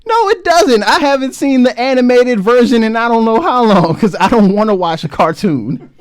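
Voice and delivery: indignant, half-amused rebuttal; loud protest. Real, not acted. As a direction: push back loudly, voice jumping up. Playful argument over The Jungle Book.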